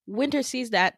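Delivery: ordinary delivery, nothing marked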